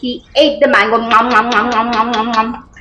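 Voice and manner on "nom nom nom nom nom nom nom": low, rhythmic nom-nom chewing; munching. Acted. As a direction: pretend munching on a mango. Eating noise in the story.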